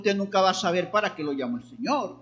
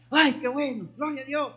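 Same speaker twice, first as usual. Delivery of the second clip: higher-pitched mock enthusiastic praise; emocionado, imitado; 7 semitones higher than usual. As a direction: act out an excited churchgoer's reaction. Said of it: "se emociona, que grita". (exclamación de alabanza entusiasta, imitada)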